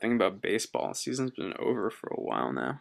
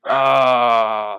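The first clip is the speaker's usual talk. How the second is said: frustrated cry; 12 dB louder than usual. Real, not acted.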